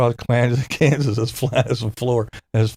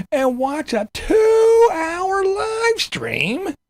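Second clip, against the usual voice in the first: much higher, slow, crying-whine parody; whiny mocking voice; slower than usual. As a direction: wail it like a sulking baby.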